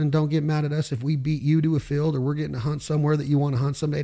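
ordinary delivery

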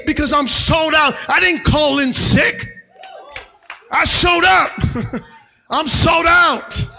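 loud rising fiery sermon; preaching. Acted. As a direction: shout it to the congregation. Preaching at full force.

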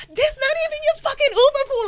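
high, loud, furious outburst; inner scream. Retold or imitated. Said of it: "inside I'm screaming".

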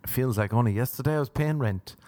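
normal talking voice

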